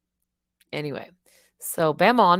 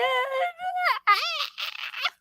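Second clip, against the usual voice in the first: squealing high giggle. Real, not acted. Giggling at herself to viewers, high squealy giggle.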